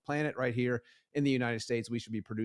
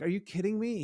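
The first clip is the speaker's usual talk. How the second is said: a mock-furious, higher-pitched disbelief; incredulous outrage; 10 semitones higher than usual. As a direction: act out a board member's indignation. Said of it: "he called me furious".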